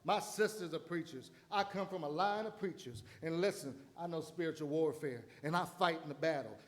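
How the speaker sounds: normal talking voice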